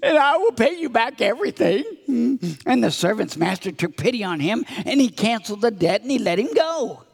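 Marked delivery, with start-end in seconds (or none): 0.0-1.8 high-pitched acted plea; begging. Servant promises his master repayment, hammed-up begging. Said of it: "he begged".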